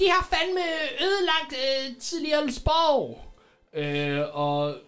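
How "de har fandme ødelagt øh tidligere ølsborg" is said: high-pitched mock outcry; crowd member's cry. Another villager calls out what the thieves destroyed, high mock shout. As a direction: complain in a high, put-on calling voice. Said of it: "der råber".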